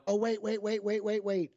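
high, quick eager cutting-in; real excited wait-wait-wait; excited interruption. Real, not acted. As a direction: jump in, you know exactly this one.

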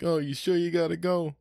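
high, mock-sorry plea; pleading host. Host asking leaving guests to stay, high pleading voice. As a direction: beg them to stay a bit longer.

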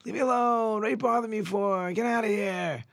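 high, loud frantic yelling; wife screaming at her father-in-law. Acted. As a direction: scream to drive him out. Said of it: "she starts screaming".

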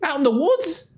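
high-pitched put-on villager voice; distant villager. Game master plays somebody a block away, high-pitched mock calling. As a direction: call over in a high, silly character voice. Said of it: "you hear somebody else, like, a block away".

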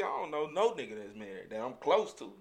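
ordinary talk, nothing marked

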